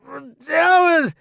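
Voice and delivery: high, loud outburst; strained, explosive swearing; cursing. A narrator's reading. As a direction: curse through pain and frustration. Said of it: "he curses".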